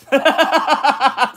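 loud high laugh, cracking up; burst of laughter. Real, not acted. Streamer cracks up reading a viewer's silly username, loud laugh. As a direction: laugh out loud at a funny name.

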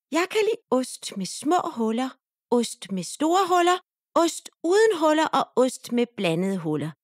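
high eager mouse listing cheeses; little mouse voice. A narrator's reading. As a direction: squeak it up, excited. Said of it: "Musen siger".

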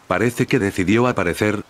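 ordinary delivery

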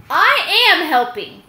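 loud, high self-pleased thought; pleased little boast; proud peacock. Acted. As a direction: crow it to yourself.

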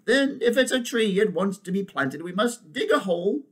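high elephant character voice; decisive she-elephant. Acted. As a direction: give orders as the elephant. Elephant figure in story.

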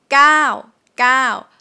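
gao with a long vowel, carefully modelled. Shown as a demonstration. Teacher modelling gao, number nine, for students. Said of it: "same sound is number nine".

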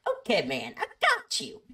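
jumping up into falsetto. Retold or imitated. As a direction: imitate a pop-star impersonator, squeaking high at the end. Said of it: "He's like".

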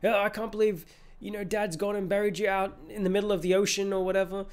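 high put-on daughter voice; voicing Anna. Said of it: "and she's like".

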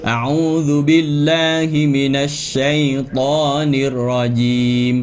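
chanted "อะอูซุบิลลาฮิมินัชชัยฏอน" with long vowels; unrounded R. Shown as a demonstration. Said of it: "อย่างเงี้ยนะฮะ".

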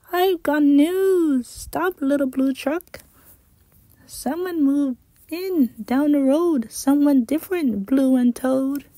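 a hen rushing to share gossip; excited hen. Acted. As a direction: hen telling the truck big news. Hen bursts in with news.

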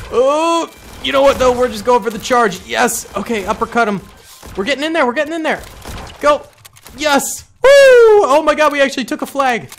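high, loud triumphant cheering; excited yelling. Real, not acted. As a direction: celebrate the flag capture at full volume. Charge succeeds, flag taken.

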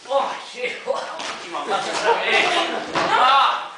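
excited shouting. Real, not acted. Excited cry at game.

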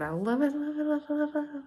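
high, sing-song repeated delight; gushing. Real, not acted. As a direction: squeal it out happily.

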